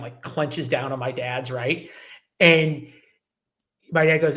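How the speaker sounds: ordinary talk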